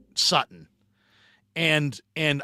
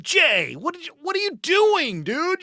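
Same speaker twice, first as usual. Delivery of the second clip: much higher, panicked what-are-you-doing; alarmed disbelief; 3 dB louder than usual. Retold or imitated. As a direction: yelp at your buddy in shock. Ball hits a golfer, alarmed.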